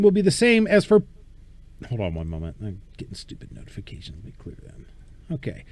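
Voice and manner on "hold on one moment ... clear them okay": murmured, half to himself; quiet aside. Real, not acted. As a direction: mutter it off mic. Aside while clearing notifications.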